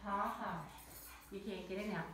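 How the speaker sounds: ordinary delivery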